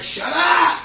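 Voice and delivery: high, loud snapping yell; unhinged villain. Acted. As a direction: scream at the voice in your head. Character tormented by inner voice.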